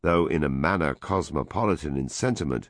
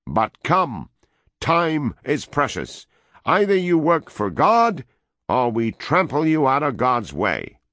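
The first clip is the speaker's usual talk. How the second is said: loud, much higher threat, slow at first; with emphasis; 10 semitones higher, 6 dB louder than usual. A narrator's reading. American threatens the woman, emphatic loud and high. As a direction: hammer home the threat with force. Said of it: "he continued with emphasis".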